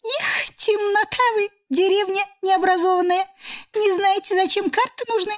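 high squeaky voice, smug and mocking; squeaky cockroach voice. A narrator's reading. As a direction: squeak down at them with a smirk. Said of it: "усмехнулся Александриус".

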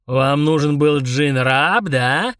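slow ominous booming question; menacing giant. Acted. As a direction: loom over the kids with threat. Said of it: "недобро переспросил Мурузух".

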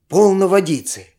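ordinary delivery, nothing marked

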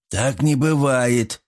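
disbelieving. A narrator's reading. Svat refuses to believe Artyom, slow and disbelieving. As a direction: draw it out, lightly incredulous. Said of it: "не поверил Артаму Сват".

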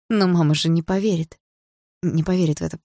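usual speaking voice

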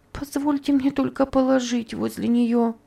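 Topsy begs to lay her flower by Eva, pleading; pleading girl. A narrator's reading. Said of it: "сказала умоляющим голосом Топси".

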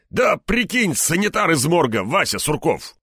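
high, exasperated grumble; complain, still worked up from yelling; grumbling. A narrator's reading. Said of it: "буркнул дежурный".

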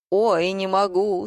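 usual speaking voice

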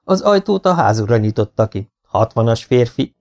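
ordinary delivery, nothing marked

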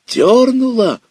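cracking up very high and loud; voice breaking high. Acted. Boy feels the big fish tug again, voice cracking. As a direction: voice breaks into a squeal of excitement. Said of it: "Голос задребезжал, сорвался".

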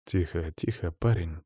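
low, soft hushing of a companion; calm it down gently and quietly; soothing. A narrator's reading. Narrator to the bristling beast as a creature nears, soothing. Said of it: "успокаивающе проговорил я".

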